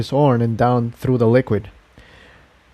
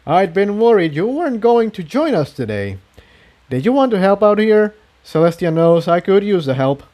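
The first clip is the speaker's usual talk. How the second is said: higher, friendly eager voice; lightly voiced stallion; 7 semitones higher than usual. A narrator's reading. Jigsaw welcoming Incendia, lightly voiced. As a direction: greet her cheerfully.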